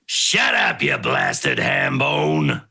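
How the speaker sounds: gruff, drawn-out roar; full-on acted shout. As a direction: bellow it like a blustering commander, stretching the words. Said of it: "actually gonna try it properly".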